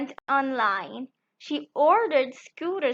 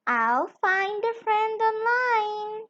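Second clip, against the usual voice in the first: chirpy chick voice; 8 semitones higher than usual. Acted. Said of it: "she chipped".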